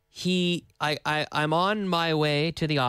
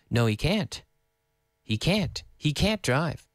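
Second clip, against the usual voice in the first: "can't" given the peak each time; stress on CAN'T. Shown as a demonstration. Teacher answers the beginner question, "can't" stressed. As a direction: lean on every "can't". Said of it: "We do emphasize can't".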